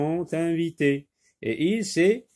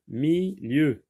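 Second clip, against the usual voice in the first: "Milieu" as two separate beats, mi, lieu; split into syllables; 3 semitones higher than usual. Shown as a demonstration. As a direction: pause inside "Milieu" after mi. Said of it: "se compose de deux syllabes".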